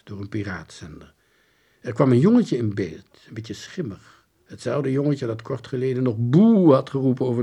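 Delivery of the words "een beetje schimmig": ordinary delivery, nothing marked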